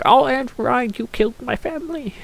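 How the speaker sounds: high, mock-grieving bad Irish accent; a botched stab at Irish lilt; failed Irish accent. Irish accent attempted by a gamer voicing a villain's fake grief. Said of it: "I can't do an Irish accent".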